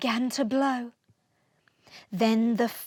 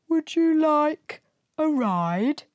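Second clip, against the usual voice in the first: whale voice; 4 semitones higher, 5 dB louder than usual. Acted. Whale offers Smoky a ride.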